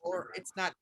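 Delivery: usual speaking voice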